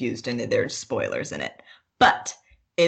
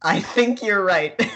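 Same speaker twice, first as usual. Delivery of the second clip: slow, laughing agreement; 6 semitones higher, 6 dB louder, slower than usual. Real, not acted. Laughing at her co-host's Void Cat mix-up. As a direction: laugh through the words.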